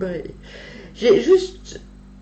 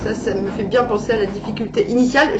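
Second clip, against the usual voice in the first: normal talking voice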